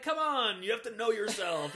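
mock frustrated dad raising his voice; exasperated father. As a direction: push the kid to answer, frustrated.

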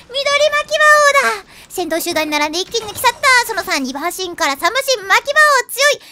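loud race call, building to a shout at the finish; horse race commentator. Pretend. Streamer calls a made-up horse race, loud excited commentator. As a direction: call the final stretch like a track announcer going wild.

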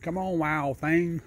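high, slow sing-song coax to the raccoon; slow coaxing call. Real, not acted. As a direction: draw it out gently to lure a wild animal.

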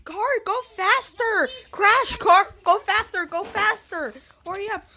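high, loud cheering on; excited urging. Real, not acted. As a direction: urge the cart on, eager. Urging the game cart on.

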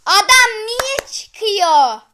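slow, high and loud complaint; exasperated yell. Real, not acted. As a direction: drag out the outrage at the leaver. Oyuncunun çıkmasına sinirleniyor. (ağır, yüksek ve tiz sesle sitem ederek)

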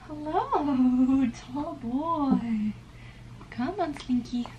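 soft cooing hello to the cat; pet greeting. Real, not acted.

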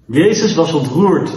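plain reading voice

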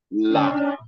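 La with a thin L and open "ah"; light Lam. Shown as a demonstration. Teacher showing Lam after Kesra, light Lam. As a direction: say La as "lah", not "law".

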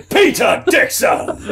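bellowed self-introduction; shouted name. Acted. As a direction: announce yourself like a showman. Shouts his own name again.

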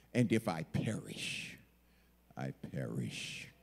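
low, hushed and sinking; solemn resolve. Acted. Esther deciding to go into the king, solemn. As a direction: let it drop slowly to a near murmur.

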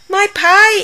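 high, loud cry of dismay; alarmed exclamation. Acted. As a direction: gasp it out as she remembers the pie. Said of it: "exclaimed Grandma".

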